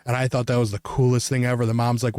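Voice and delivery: ordinary delivery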